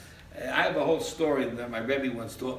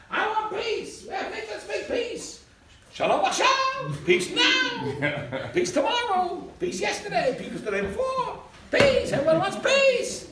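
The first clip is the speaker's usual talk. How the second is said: much higher, laughing mock voice of naive peace-seekers; mocking peace lover; 4 dB louder, slower than usual. Mocking naive peace-seekers for the class, much higher laughing mock voice. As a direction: ham up a giddy crowd chanting for peace.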